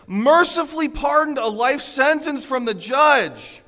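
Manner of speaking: loud, high, driving sermon voice; forceful preaching. Acted. Pastor preaching to the congregation, loud and driving. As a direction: preach it hard to the whole room.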